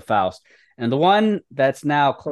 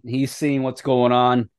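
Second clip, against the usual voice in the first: usual speaking voice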